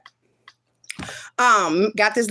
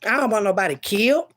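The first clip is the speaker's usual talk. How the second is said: usual speaking voice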